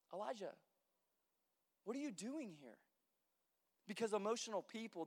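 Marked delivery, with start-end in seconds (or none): 0.1-2.8 soft, low, quiet question; gentle God. Retold or imitated. God asking Elijah softly where he is. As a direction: ask it gently, almost hushed. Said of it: "God's like".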